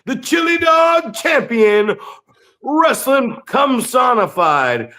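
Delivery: booming hype-man intro; announcer shout. Pretend. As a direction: bellow it like introducing a champion. Hyping Fuego like a ring announcer.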